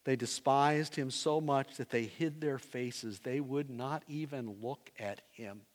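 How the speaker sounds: normal talking voice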